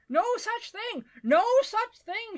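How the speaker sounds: high, vehement protest; top-of-voice shout. A narrator's reading. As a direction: shout them down, building in volume. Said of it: "shouted Mister Badger at the top of his voice".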